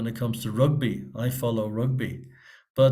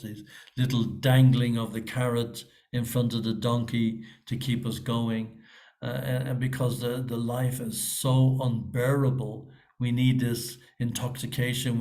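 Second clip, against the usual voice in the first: usual speaking voice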